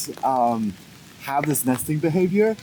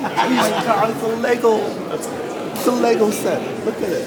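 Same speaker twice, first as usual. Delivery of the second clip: high, loud delighted squeal; excited; 11 semitones higher, 4 dB louder than usual. Real, not acted. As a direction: gush over a find. Spots a Lego set, thrilled.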